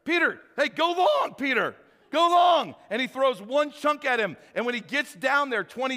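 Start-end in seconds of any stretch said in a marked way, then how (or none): 0.0-2.7 high, loud call across a field; Jesus shouting to Peter to run out for a pass; playful shout. Retold or imitated. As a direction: yell go long like a quarterback. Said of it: "I see Jesus going".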